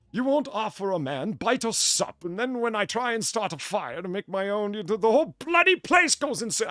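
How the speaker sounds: high, hammed-up attempt at an Irish brogue; bad Irish accent. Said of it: "I can't do an Irish accent".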